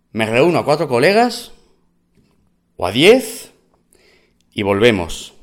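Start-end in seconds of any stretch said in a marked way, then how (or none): none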